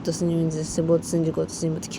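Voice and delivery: ordinary delivery, nothing marked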